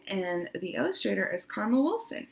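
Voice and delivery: usual speaking voice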